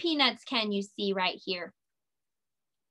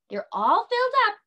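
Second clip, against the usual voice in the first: excited; 10 semitones higher, 4 dB louder, faster than usual. Real, not acted. Excited about full frame.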